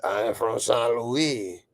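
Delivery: slow mock French accent. Host playing a viewer from France, mock French accent. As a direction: put on a French accent, Louis as "loo-ee".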